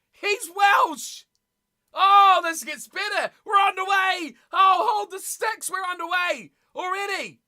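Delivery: high, loud fan yelling; thrilled, disbelieving fan shouting at the screen; excited shouting. Real, not acted. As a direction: shout it out as kickoff arrives. Excited fan as the match kicks off.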